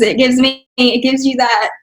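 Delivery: usual speaking voice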